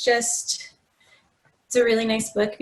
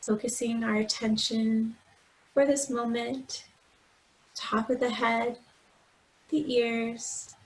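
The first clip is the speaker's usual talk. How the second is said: guided meditation; 4 dB quieter than usual. Acted. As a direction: gently guide them through breath and body, unhurried. Leads a body scan meditation.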